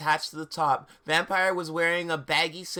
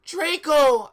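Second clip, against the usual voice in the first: high, loud call of the name; high loud worried call; cried out; 10 semitones higher, 9 dB louder than usual. Acted. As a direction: call out to him in alarm. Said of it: "I cried".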